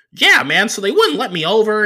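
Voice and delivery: high, loud put-on driver voice; Uber driver voice. Comic telling the crowd, mimicking his driver high and loud.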